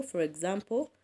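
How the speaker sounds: ordinary talk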